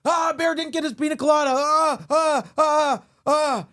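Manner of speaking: high, loud fast panicked cries; panicked sleep-talk. Retold or imitated. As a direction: cry out in a nightmare panic. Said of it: "I'd be sleeping like".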